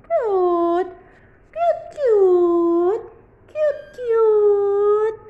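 loud high squeals of cute; squealing cute. Real, not acted. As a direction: squeal over the kitten. Squealing over kitten.

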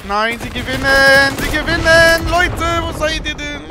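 loud high desperate yell; panicked shouting. Real, not acted. Enemy team about to win.

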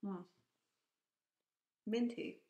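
talking around mouth tray; whitening tray in mouth. Real, not acted. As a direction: lips closed over tray. Seen 1: Right speaker fitted the tray at -12s; it is still over her teeth.

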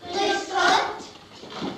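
a class answering together, drawn out; children's chorus greeting. Acted. Class greets the police officer in chorus. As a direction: greet the visitor as one class of kids.

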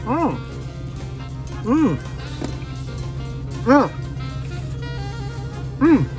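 ordinary talk

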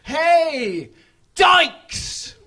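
loud, high jeering yell; mock shout. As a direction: bellow it out of a passing car. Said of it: "they shouted".

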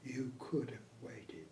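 a quiet, reproachful aside; hushed rebuke to the pub landlady. Retold or imitated. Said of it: "in a quiet voice".

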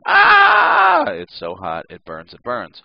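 high sharp yelp of pain; scream. Retold or imitated. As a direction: shriek as if burned by the rack. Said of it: "I scream".

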